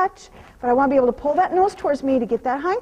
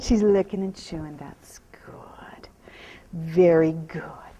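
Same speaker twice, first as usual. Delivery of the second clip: hushed, slow whispered praise; 8 semitones lower, slower than usual. Real, not acted. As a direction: murmur it so the horse settles. Calming the horse.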